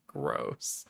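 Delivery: breathy whisper of disgust. Acted. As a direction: whisper the word Gross.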